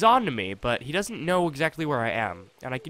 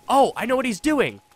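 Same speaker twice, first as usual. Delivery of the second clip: higher, louder burst of discovery; excited realization; 7 semitones higher, 6 dB louder than usual. Real, not acted. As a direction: blurt it out as it clicks. Sudden realization in the game.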